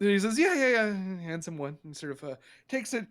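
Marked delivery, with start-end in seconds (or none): 0.4-0.9 higher, louder, eager yeah-yeah-yeah; a keen alchemist happily agreeing; eager agreement. Acted. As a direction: rush to agree with him.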